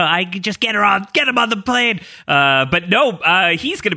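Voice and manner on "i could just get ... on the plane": imitating a frantic yell, pitched up; mock yell. Co-host retelling Jack's outburst at the airport, mock yell. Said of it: "Jack yelling at her".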